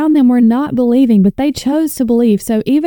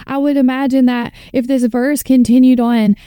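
ordinary talk

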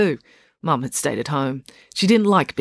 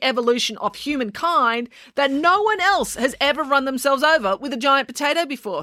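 higher, deadpan put-down; dry sarcasm; 10 semitones higher than usual. Acted. Scene: April mocking Finn's vow, higher and deadpan. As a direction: deflate Finn's heroics.

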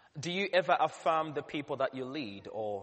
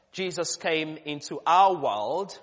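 loud, slow proclaiming; preaching voice; 4 semitones higher, 11 dB louder, slower than usual. Acted. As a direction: proclaim it to the whole church. Preaching at full force.

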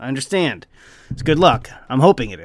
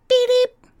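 bird beeps. Retold or imitated. Mimicking the parrot's call.

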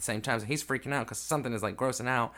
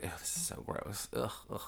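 hushed, much softer grossed-out voice; imitated disgust; 12 dB quieter than usual. Drew freaking out after the cat catch, quietly disgusted. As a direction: mimic someone repulsed, almost under the breath. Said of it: "He's freaking out".

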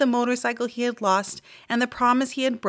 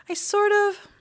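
higher, hesitant little mouse; sheepish Ralph; 13 semitones higher than usual. A narrator's reading. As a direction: confess it reluctantly. Embarrassed Ralph admits loss.

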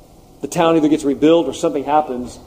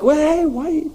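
much higher, disbelieving question; incredulous why; 12 semitones higher, slower than usual. Retold or imitated. Reacting to the slaughter of women and children with mock disbelief. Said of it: "I'm like".

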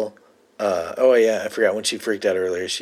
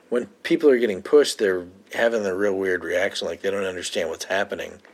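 ordinary delivery

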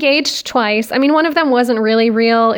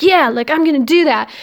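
motivated; 4 semitones higher, 4 dB louder than usual. Retold or imitated. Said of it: "you're like".

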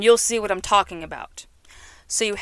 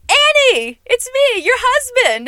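high, loud mock-joyful call, far above the usual voice; hammed-up husband greeting; 8 dB louder, slower than usual. Carpenter claiming the amnesiac woman as his wife, hammed-up loud greeting. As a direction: belt it out like a gleeful fake husband.

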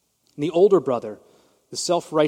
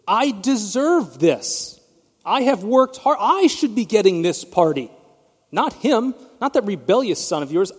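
higher, louder, put-on whine of a resentful son; mock entitled whine; 6 semitones higher, 6 dB louder than usual. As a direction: ham up the spoiled older brother. Older son demands the party.

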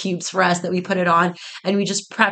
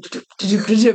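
rapid heartbeat noise made with the voice; imitated pounding heartbeat; 3 semitones higher, 4 dB louder, faster than usual. Founder recalling nerves as the doors open, imitated heartbeat. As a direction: mimic a racing heart in quick syllables. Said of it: "feeling my heartbeat out of my chest".

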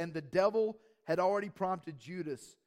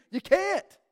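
high ringing preacher's cry, louder; emphatic shout; 12 semitones higher, 12 dB louder than usual. Real, not acted. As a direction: call it out to the whole church. Preacher rebuts excuses.

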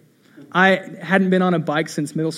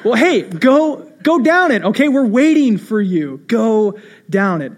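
urging shout; 4 semitones higher, 4 dB louder than usual. Retold or imitated. Friends urging him downhill.

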